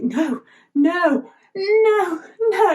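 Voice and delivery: high, drawn-out mock-villain protest; villain's no. King floating away in the bubble, hammed-up villain protest. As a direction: wail it like a thwarted cartoon villain. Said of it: "villains say no a lot".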